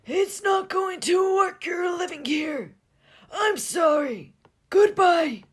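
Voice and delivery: put-on very high, loud, slow old voice; old ghost voice. The old Captain sends Gus away, high slow shouting voice. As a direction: turn him out firmly but sorry.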